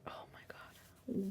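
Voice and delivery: very soft, slow retold gasp of dread; hushed dismay. Mother recalling her daughter was missing, hushed dismay. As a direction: breathe it out slowly, barely audible.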